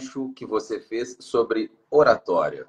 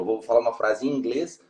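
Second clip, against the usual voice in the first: ordinary talk, nothing marked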